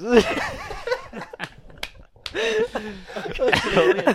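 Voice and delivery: high cackling laugh; loud laughing fit. Real, not acted. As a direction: burst out laughing hard. Group cracking up.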